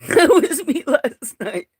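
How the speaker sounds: very high, loud laugh, then slow words. Real, not acted. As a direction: burst out laughing at your own photo. Laughs at her own photo.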